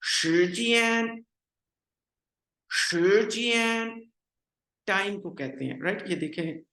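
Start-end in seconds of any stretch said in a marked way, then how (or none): 0.0-4.0 शिजियान joined smoothly, slow and higher; teacher saying the word joined up; blended Mandarin word. Shown as a demonstration. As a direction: run the two syllables together. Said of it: "मिला के कैसे लफ्ज बोलेंगे".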